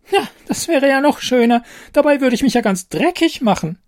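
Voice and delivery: a tiny fairy too fine to get dirty; prissy fairy. Acted. Bitchy fairy refuses dirty work.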